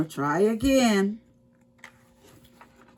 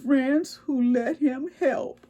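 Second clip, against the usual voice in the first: sad squirrel; 7 semitones higher than usual. A narrator's reading. Squirrel sad about Duck.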